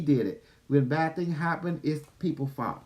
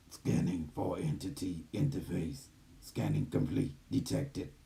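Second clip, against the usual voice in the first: gentle care robot; 8 semitones lower, 6 dB quieter than usual. Acted. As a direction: speak as a calm channeled AI. Channels the AI system.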